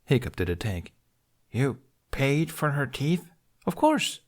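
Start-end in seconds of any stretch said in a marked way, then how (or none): 1.5-3.3 slow, disbelieving question; can't believe what he hears; incredulous. A narrator's reading. Hiccup incredulous at Tooth. 3.7-4.2 high, bright matter-of-fact; as if it's obvious; Tooth's chirpy voice. A narrator's reading.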